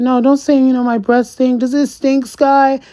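playful mock of a carefree girl. Voicing [name]'s high mock voice.

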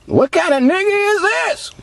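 high-pitched mock amazement; imitated astonishment. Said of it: "That's what they supposed to say".

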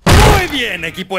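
loud, high rallying call; rally the crew with a shout; calling out to the team. Acted. Llama a su equipo. (grito para reunir al equipo)

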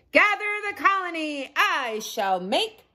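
high, slow royal proclamation; slow regal command. A narrator's reading. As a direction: proclaim like a wise old king. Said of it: "in a heroic, regal voice".

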